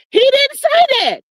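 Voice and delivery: high-pitched mimicked protest; squeaky-high retold denial; mock denial. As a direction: imitate someone backtracking, voice jumping up. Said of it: "wanna come back and say".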